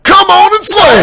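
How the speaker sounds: hammed-up cry. Fighting in the game.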